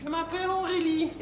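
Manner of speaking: put-on high, sing-song girlish falsetto; ditzy bimbo. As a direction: answer in a silly high bimbo voice. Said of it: "comment tu t'appelles?". (voix aiguë de bimbo)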